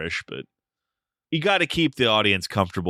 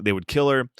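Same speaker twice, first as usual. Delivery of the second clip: ordinary talk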